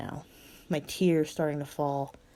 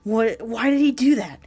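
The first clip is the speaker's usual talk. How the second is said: shocked; 6 semitones higher, 9 dB louder than usual. Acted. Lizzie shocked.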